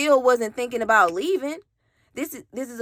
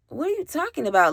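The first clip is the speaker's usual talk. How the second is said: higher, fast baffled question; confused; 6 semitones higher, faster than usual. Retold or imitated. Baffled girlfriend asks her ranting boyfriend, fast and confused. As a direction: toss it back fast, baffled.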